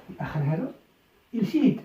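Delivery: normal talking voice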